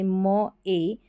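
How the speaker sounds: slow, letter by letter; sounded out. Shown as a demonstration. Teacher sounding out मे for students slowly. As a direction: stretch the sounds apart. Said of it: "मे हो गया".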